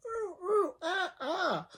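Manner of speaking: put-on very high squeaky baby hoots; imitated baby noises. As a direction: hoot in a tiny high voice. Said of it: "I'm making baby noises".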